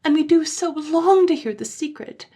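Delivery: put-on high child voice crying out; high childish insistence; crying children. A narrator's reading. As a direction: insist like eager little children.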